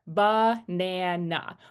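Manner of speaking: banana very slowly as "buh, nan, uh"; schwa syllable by syllable. Shown as a demonstration. Teacher breaking down banana for learners, slow "buh-nan-uh". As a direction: stretch banana into three parts with uh at both ends. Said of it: "We have the uh sound there".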